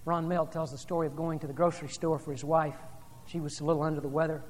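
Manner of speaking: ordinary talk, nothing marked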